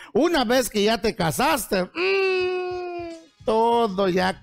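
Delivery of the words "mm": high, held "mmm" fading away; long drawn-out hum. Acted. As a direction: hold a high comic hum. Mimes reaction to married life. (un "mmm" agudo y sostenido)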